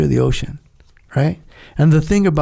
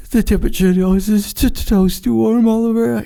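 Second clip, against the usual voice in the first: imitating crying; 7 semitones higher than usual. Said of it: "And I'm crying".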